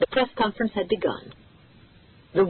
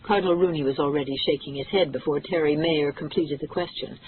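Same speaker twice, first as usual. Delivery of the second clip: ordinary delivery, nothing marked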